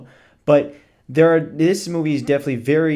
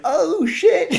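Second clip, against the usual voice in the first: put-on much higher, laughing kid voice; mock kid reaction; 3 dB louder than usual. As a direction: squeal it like a shocked kid, half laughing. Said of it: "It's like".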